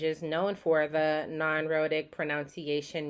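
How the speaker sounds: ordinary talk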